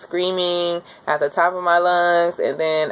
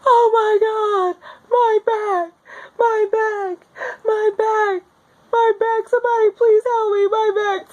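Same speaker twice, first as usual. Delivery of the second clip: high, pained crying wail; mock labor scream; slower than usual. Retelling labor, wailing at the nurses in pain. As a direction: wail through a contraction pain. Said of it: "this is me screaming out loud".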